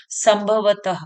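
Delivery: "संभवतः" ending in breathed ha; visarga h sound. Shown as a demonstration. Teacher showing a sound like ह, ha closing "संभवतः". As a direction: say "संभवतः" as sam-bha-va-ta-ha. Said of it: "ह के समान सुनाई दे रही है".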